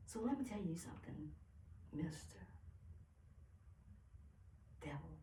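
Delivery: very soft, spaced-out address; hushed warning; quiet confrontation. Acted. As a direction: address the devil softly, with long pauses.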